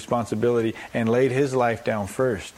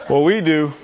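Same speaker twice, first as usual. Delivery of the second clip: ordinary delivery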